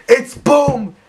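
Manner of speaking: high, loud hammy shout; yelled activation call. Retold or imitated. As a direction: yell it as a voice command. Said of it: "So I say it's boom".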